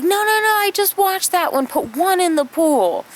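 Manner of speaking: very high, insistent big sister; a sister ordering her brother around; bossy sister. Retold or imitated. Kim ordering her brother which foot goes in, high and bossy. Bossing brother about feet.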